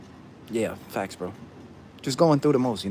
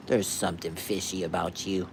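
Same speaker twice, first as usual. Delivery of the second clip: suspicious doubter voice; 5 semitones lower, 5 dB quieter than usual. Pretend.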